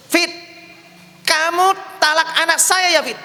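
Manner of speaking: loud, high-pitched accusing phone call; indignant mother-in-law. Acted. Mother phoning son-in-law about divorce, indignant. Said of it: "Mama yang telepon suamimu".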